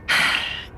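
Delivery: weary breath out; fed-up sigh; tired sigh. A narrator's reading. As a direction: sigh before answering. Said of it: "Alessandra muttered, tired".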